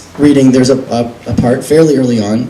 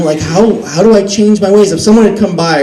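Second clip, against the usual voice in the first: ordinary delivery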